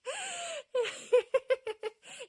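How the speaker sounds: high loud burst of laughter; big laugh. Real, not acted. Laughing at the cheesecake filling mix-up, loud high laughter. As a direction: laugh hard at the punchline.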